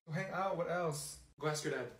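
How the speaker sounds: ordinary talk, nothing marked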